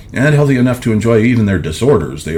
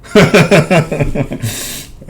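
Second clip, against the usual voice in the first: amused laugh; 10 semitones higher, 3 dB louder than usual. Real, not acted. Reader laughs at the character.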